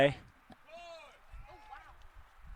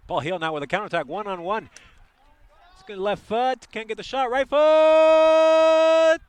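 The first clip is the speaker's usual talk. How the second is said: building from fast, loud and high to a full scream on the shot; rising play-by-play; 13 semitones higher, 11 dB louder than usual. Real, not acted. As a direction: ride the attack higher and louder until the shot. Live counterattack play-by-play.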